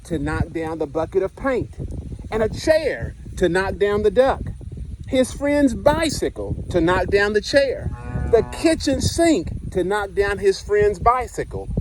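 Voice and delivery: lively picture-book narration, dwelling on the punchlines; reading to children. Acted. Teacher reading Stuck to the class, storytime voice. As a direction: read it like storytime on the carpet.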